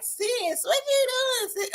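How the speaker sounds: high, Southern drawled greeting; imitating a high Southern twang; Southern country voice. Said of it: "They, they be like".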